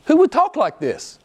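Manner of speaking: high-pitched mock indignation; mock outrage. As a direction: act out a scandalized critic, pitched high. Mimics outraged critics of Paul.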